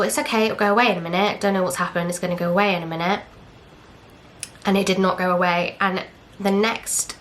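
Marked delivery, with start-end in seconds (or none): 0.0-3.2 fast, anxious muttering to herself; rushed self-reassurance. Retold or imitated. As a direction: hurry through it, trying to stay calm. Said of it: "And I thought".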